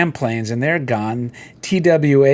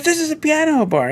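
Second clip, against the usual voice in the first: high, scornful "This is a piano bar"; hammed-up grumpy pianist; pianist scoffing; 13 semitones higher than usual. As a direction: scold the guest like a cranky pianist. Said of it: "He goes".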